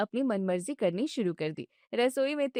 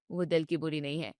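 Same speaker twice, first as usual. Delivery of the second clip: soft calm husband; 6 semitones lower, 4 dB quieter than usual. Acted. Husband assures wife his mother means well, soft and low. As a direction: lower and much softer, reassuring.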